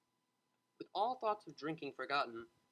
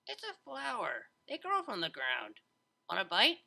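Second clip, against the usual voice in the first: higher, lightly voiced teasing unicorn; playful Vinyl; 5 semitones higher than usual. A narrator's reading. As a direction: offer the bite like a dare.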